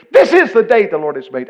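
loud, high scripture declaration; preacher raising the voice on a verse; ringing proclamation. Acted. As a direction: proclaim the verse out to the whole crowd.

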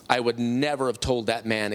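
ordinary delivery

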